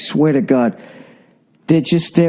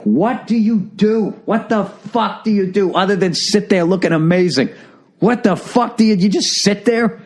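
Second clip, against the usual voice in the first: higher-pitched, incredulous mock rant; exasperated demand; 7 semitones higher than usual. Comic imagining confronting beautiful women, exasperated. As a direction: demand an answer, stretching the first question out. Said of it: "always fight the urge to be like".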